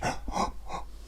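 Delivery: quiet breathy yawn; soft demo yawn. Shown as a demonstration. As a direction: yawn softly to show them. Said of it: "Let's have a yawn".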